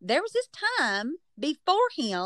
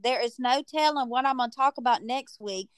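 ordinary talk

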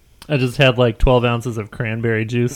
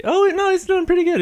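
excited. Real, not acted. Commentating friend chugging drink.

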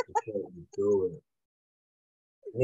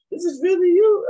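incredulous teacher; 9 dB louder than usual. Retold or imitated. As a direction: imitate loud, high-pitched disbelief. Retold teacher doubts his name.

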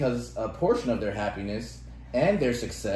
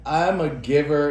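slow, louder self-satisfied "I'm a giver"; mock smug boast; 4 semitones higher, 7 dB louder, slower than usual. Mocking people who call themselves givers, slow and smug. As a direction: parody a braggart announcing it. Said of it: "is just the ultimate of".

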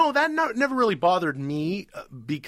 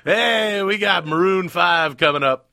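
higher, louder upbeat DJ announcement; radio DJ voice; 4 semitones higher, 5 dB louder, slower than usual. Pretend. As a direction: pitch the next song like a DJ. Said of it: "Go back to the radio thing".

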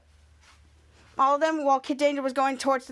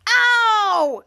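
loud, high, drawn-out "ow"; pained yell; 6 semitones higher, 6 dB louder than usual. Retold or imitated. Hero whose foot got stomped, pained yell. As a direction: act out a howl of pain.

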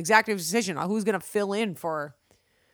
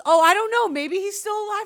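mother's voice; 9 semitones higher, 3 dB louder than usual. Retold or imitated. Mother's careless reply retold.

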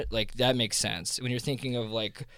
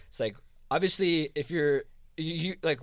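ordinary talk, nothing marked

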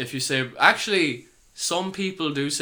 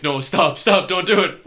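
put-on girlfriend pleading; 5 semitones higher, 9 dB louder, slower than usual. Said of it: "And she's like".

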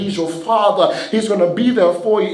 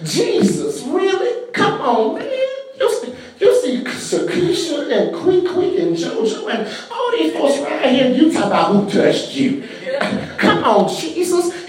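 lightly voiced disciple, higher with a laugh; mock disciple voice; 8 semitones higher than usual. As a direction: play the disbelieving disciple, half laughing. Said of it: "I'm just paraphrasing".

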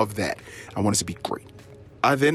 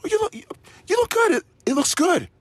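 forced reassurance; faster than usual. Retold or imitated. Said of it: "imitating husband's forced reassurance".

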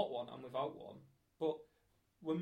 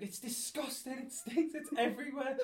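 a light mock of her disgust, much higher; hamming up her disgust, pitched way up; imitating disgusted girlfriend; 11 semitones higher, 5 dB louder than usual. Girlfriend complaining about the toilet, lightly mocked, higher. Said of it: "She's like".

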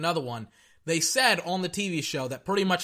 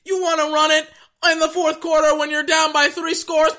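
high, loud outraged disbelief; shouted, high-pitched exasperation; incredulous mock shout; 10 semitones higher, 9 dB louder than usual. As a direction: yell it like you cannot believe the call. Mimics incredulous reaction to play call.

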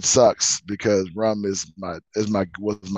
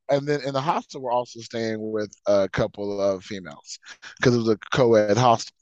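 usual speaking voice

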